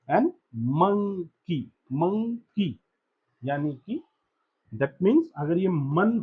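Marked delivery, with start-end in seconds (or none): 0.8-2.7 "monkey" broken as mon, then key; syllable by syllable. Shown as a demonstration. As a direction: say each "monkey" with a small gap before "key". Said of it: "मन and की मिलाया".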